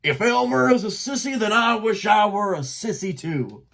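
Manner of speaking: loud, ringing defence; booming. A narrator's reading. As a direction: defend Elmer to the laughing flock. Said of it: "a voice boomed forth".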